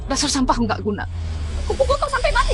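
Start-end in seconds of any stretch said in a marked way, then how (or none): 1.6-2.5 high furious cry at her son; furious threat. Acted. Mother screams at the son who ruined her plan, furious cry. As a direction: lash out at the worthless son.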